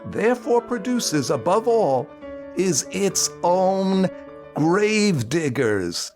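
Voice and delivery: ordinary talk, nothing marked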